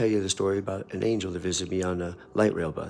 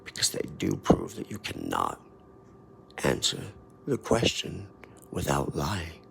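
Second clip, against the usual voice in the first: lower, soft, half-whispered finish; softer, lower and slow to the amen; hushed closing; 6 semitones lower, 7 dB quieter than usual. Acted. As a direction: confide the ending in a hush.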